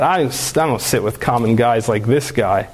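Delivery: lightly mocking a haughty mayoress, low; a hint of a snobbish lady's sneer; snooty aside. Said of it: "she says this in a French accent".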